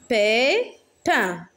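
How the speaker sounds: "Pépin" split into pé, pin; rising "pé", pause, falling "pin" in "Pépin"; syllable by syllable. Shown as a demonstration. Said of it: "On a deux syllabes". (« Pépin » découpé en deux syllabes)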